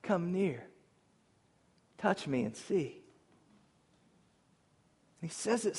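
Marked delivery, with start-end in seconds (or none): 0.0-3.0 tender. A narrator's reading. Jesus inviting terrified disciples to touch him: tender, hushed. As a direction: gently coax frightened friends closer. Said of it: "Jesus so tenderly says".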